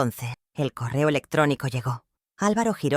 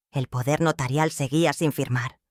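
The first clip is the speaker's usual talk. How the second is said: ordinary delivery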